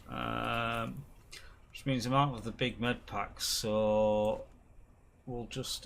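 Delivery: usual speaking voice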